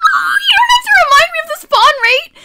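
high, loud wail of frustration; exasperated shout. Real, not acted. Streamer to chat about witch spawns, shrieking exasperation. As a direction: scream it at chat, fed up.

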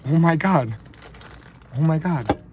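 loud, high cries of disbelief; stunned excitement. Real, not acted. Card opener reacting to the Gretzky auto, stunned excitement. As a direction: exclaim it in real shock. Still reacting to the huge hit.

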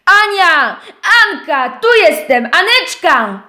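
głośniejsze wołanie; wołaj Anię głośno; wrzask. Acted. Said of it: "wrzasnął". (miś głośno woła Anię)

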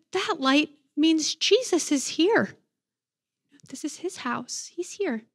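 slow and careful, dropping to a hush; treading lightly. Retold or imitated. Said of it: "treading very lightly".

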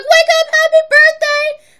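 loud, very high sing-song wake-up; best friend waking her for her birthday, shrieking; excited birthday shout. Retold or imitated. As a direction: yell it like a thrilled best friend. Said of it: "She was like".

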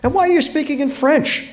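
high-pitched incredulous demand. A narrator's reading. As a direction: demand an explanation, voice leaping up in pitch. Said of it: "I demanded".

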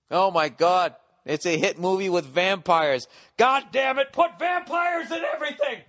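greedy studio boss rising into a high shout; mock movie executive. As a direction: yell orders like a frantic producer.

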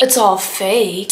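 low, slow letdown; flat deadpan. Acted. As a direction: drop to a glum deadpan. Deadpan drop.

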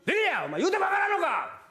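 high scolding yell; retold angry shout. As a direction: blow up at the kid. Father scolding toddler.

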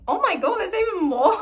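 loud, high burst; excited surprise. Real, not acted.

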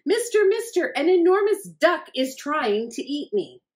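high, loud frantic cry for help; panicked plea. Acted. Hector begging the ranger for help, high and frantic.

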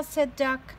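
teacher reading a picture book aloud to her class; reading to children. A narrator's reading. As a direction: read it out with energy for little kids.

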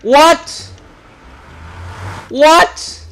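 high, loud disbelieving what; loud shocked outburst; shocked shout. Real, not acted. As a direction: yelp of disbelief. Discovers his bed is gone.

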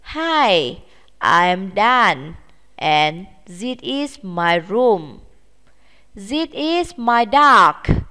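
put-on slow, clear lyric read for children; reading lyrics aloud. Shown as a demonstration. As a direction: read each line slowly for the class. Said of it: "cùng nhau đọc và dịch lời của bài hát".

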